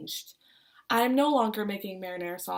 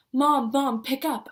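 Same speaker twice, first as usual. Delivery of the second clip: urgent calling out, louder; frantic yell; 3 semitones higher, 5 dB louder, slower than usual. Retold or imitated. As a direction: shout for mom to answer the phone. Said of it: "I yell, my voice shaking".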